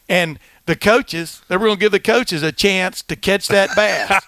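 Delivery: lisping storyteller, "bass" as "bath"; Lou Holtz impression. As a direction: draw out "coaches" with a thick lisp. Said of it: "a lot of a lisp".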